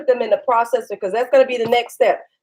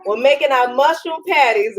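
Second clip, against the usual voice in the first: ordinary talk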